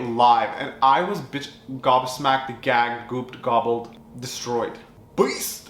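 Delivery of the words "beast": excited shout. Real, not acted. Excited exclamation ending.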